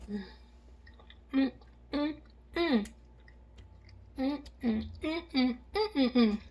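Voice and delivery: string of pleased mm sounds; savoring hums. Real, not acted. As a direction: hum happily while chewing. Tasting food on camera.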